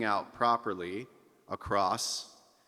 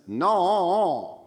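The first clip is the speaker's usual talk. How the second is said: higher, louder no with nasal ring; 9 semitones higher, 7 dB louder than usual. Shown as a demonstration. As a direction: ring it through the nose. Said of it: "If I add a little bit of nasal ring to it".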